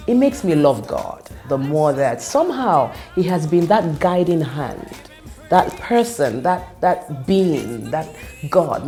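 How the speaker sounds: ordinary delivery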